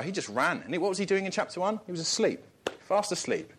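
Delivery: ordinary talk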